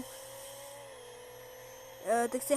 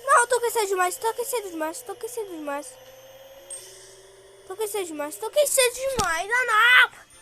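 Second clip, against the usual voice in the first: panicking; 8 semitones higher, 3 dB louder than usual. Real, not acted. Overheating car, panicking.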